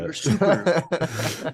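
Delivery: slow, laughing through the word; drawn out while giggling. Real, not acted. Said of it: "you're super, you're super diverse".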